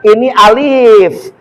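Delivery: very slow, drawn-out letter names; a parent slowly teaching a child the alphabet. Shown as a demonstration. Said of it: "teaching the first letters".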